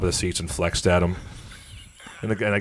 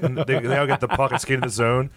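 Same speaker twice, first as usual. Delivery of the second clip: ordinary delivery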